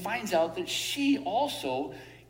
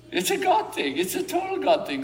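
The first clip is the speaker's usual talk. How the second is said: excited; 11 semitones higher, 5 dB louder than usual. Real, not acted. Exclaiming about God's hand.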